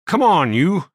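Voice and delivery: gruff judge losing patience. A narrator's reading. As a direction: bark at the prisoner to hurry. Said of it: "Shunk Wilson said gruffly to Smoke".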